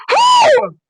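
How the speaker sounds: relieved whew. Real, not acted. Relief after near miss.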